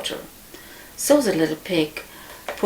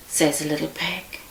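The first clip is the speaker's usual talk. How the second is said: ordinary delivery, nothing marked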